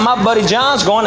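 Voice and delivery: normal talking voice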